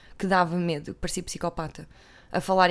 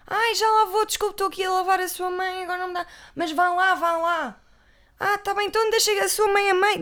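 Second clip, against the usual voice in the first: high, loud shouting between rooms; hammed-up house scene; 10 semitones higher, 6 dB louder than usual. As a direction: ham it up, calling across the house much higher. Acted scene Teresa and maid.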